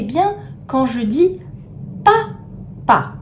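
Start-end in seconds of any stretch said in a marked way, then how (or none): 2.0-3.1 papa split into two separate high syllables; papa in detached, high-pitched beats for counting. Shown as a demonstration. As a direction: say papa as pa, pause, pa. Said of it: "compter les syllabes".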